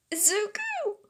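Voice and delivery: high, strained call for help; desperate cry. Acted. Choked girl to boy, desperate cry.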